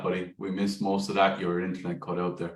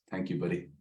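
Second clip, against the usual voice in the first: usual speaking voice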